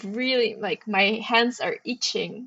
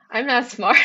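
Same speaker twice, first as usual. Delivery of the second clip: I'm not smart said with a laugh; 8 semitones higher, 4 dB louder than usual. Real, not acted. Admitting a mistake, laughing. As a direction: laugh at yourself.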